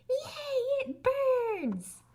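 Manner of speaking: very high squeaky robot scream of joy; happy scream. Acted. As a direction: squeal with delight at the pain. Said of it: "he did happily scream".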